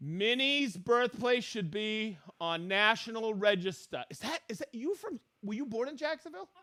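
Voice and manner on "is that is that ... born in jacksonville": fast quiet aside. Real, not acted. Quick aside to Minnie.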